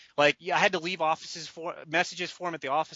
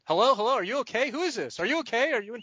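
higher-pitched, mildly alarmed phone voice; mock worried questioning, pitched up; imitated concerned caller; 6 semitones higher, faster than usual. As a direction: act out someone checking on a struggling caller. Alarmed listener on phone, retold.

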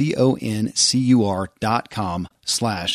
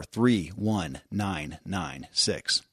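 slow number readout; 4 semitones lower, 8 dB quieter, much slower than usual. Shown as a demonstration.

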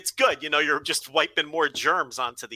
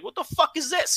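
Italian street guy; 11 semitones higher than usual. Retold or imitated. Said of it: "He'd be like".

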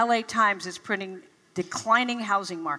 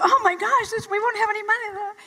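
panicky; 11 semitones higher than usual. Retold or imitated. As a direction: blurt it out in rising alarm. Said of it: "I started to get very panicky".

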